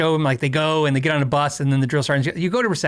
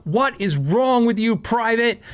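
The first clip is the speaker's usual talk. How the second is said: mild mock yell, pitched up and slow; hammed-up drill sergeant barking at a recruit; 8 semitones higher, slower than usual. Drill sergeant to shivering private, mild mock yell. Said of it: "he just starts screaming in my face".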